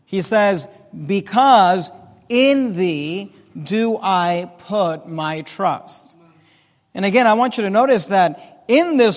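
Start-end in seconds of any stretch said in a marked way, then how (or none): 0.9-6.1 solemn scripture reading. Acted.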